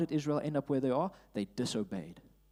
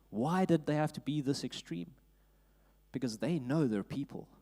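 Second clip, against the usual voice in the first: ordinary talk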